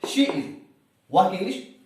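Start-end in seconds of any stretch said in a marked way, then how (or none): 0.1-0.4 She is snapped out higher and louder; quick answer. Shown as a demonstration. Teacher shows students to answer She is at once, high and loud. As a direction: fire back She is instantly. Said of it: "आप फौरन कहें".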